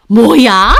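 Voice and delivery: loud outraged retort; affronted, loud burst. A narrator's reading. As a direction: explode in indignation. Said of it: "어머님과 제 언성이 높아지자".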